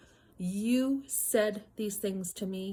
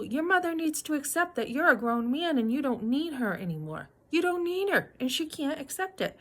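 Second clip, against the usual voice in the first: raised, pointed scolding; retold confrontation; 6 semitones higher, 3 dB louder than usual. Wife scolding her husband about his mother, raised and pointed. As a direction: tell him off, pitch up on need. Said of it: "I go".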